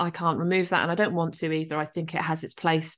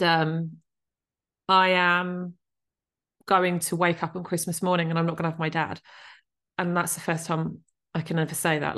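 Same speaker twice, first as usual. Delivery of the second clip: normal talking voice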